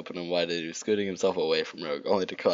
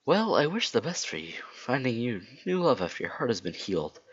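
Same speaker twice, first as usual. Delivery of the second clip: high, earnest cartoon voice; well-wishing Sonic; 7 semitones higher than usual. Sonic wishes Rogue new love, high earnest voice. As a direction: wish it sincerely, pitched up high.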